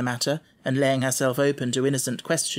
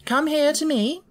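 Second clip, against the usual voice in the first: high, louder headmistress call; schoolroom manner; 13 semitones higher, 3 dB louder than usual. A narrator's reading. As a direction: summon the pupil sternly. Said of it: "in her schoolroom manner".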